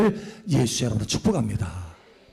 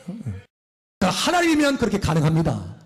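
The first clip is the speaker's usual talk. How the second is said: normal talking voice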